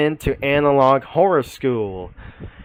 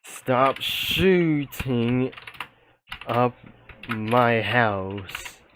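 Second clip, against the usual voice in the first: reading the caller's line; much slower than usual. Retold or imitated. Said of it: "It says".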